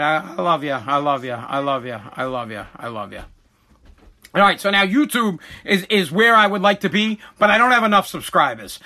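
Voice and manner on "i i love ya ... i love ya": low, fading murmur of affection; tender reassurance. Real, not acted. Dad soothing child before they leave, tender and fading. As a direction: repeat it softly, sinking lower each time. Father repeats love to child.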